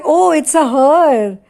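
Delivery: lightly acted, drawn-out "oh"; mock-knowing, slow reaction; teasing realization. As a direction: draw it out like a friend catching on. Friend reacts teasingly to news.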